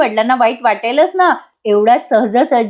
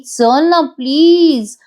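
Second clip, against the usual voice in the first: higher, long drawn-out please; pleading; 4 semitones higher, much slower than usual. Acted. Jiva begs Nandini to come along, drawn-out pleading. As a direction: beg with a very slow, stretched plea.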